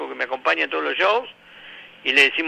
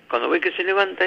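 ordinary delivery

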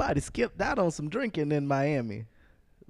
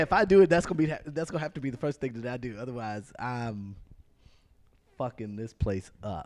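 usual speaking voice

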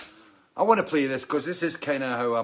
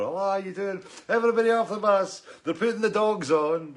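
higher, louder mock border guard shouting orders; 9 semitones higher, 6 dB louder than usual. Musician retelling border stop, imitating a loud guard. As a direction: bark the guard's orders at the bus. Border officials voiced in story.

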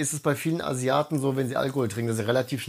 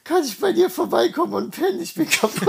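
high, giggly, wasted pleading; drunk whiny plea; 14 semitones higher, 4 dB louder than usual. Retold or imitated. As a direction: beg like a tipsy friend. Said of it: "ich so".

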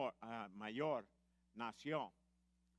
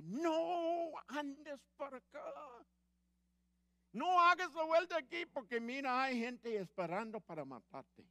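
urgent warning; 8 semitones higher, 6 dB louder than usual. Acted. Holy Spirit voiced warning the listener.

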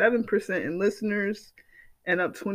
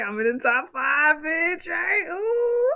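triumphant squeal; 10 semitones higher, slower than usual. Real, not acted. Celebrating her top five podcast with viewers, squealing brag. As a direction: crow about it like a winner.

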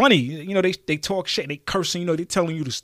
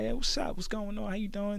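higher, softer smooth-talker greeting; smooth flirty greeting; 7 semitones higher, 6 dB quieter than usual.

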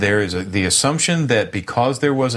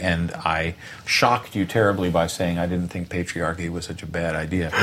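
ordinary delivery

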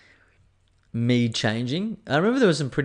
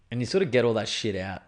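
usual speaking voice

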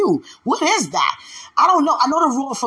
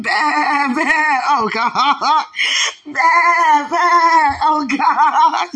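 higher, louder, sung through laughter; laughing sing-song; 5 semitones higher, 5 dB louder, much slower than usual. Real, not acted. Woman joking about being a lamb at home, laughing in sing-song. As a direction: crack yourself up singing it. Cracking up at her joke.

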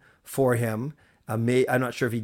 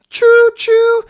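very high, loud train whistle imitation; imitated train whistle, pitched way up and belted out; choo-choo train sound; 12 dB louder than usual. Shown as a demonstration. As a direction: hoot like a train in a high falsetto. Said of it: "that amazing choo-choo sound I just made".

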